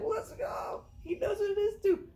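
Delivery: high whooping cheer; cheering that a coworker gets the joke; excited cheer. Retold or imitated. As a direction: celebrate like you just won. Celebrating coworker knows meme.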